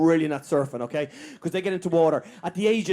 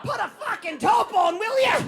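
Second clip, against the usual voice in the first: mocking shout. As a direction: yell it like a heckler at the beach.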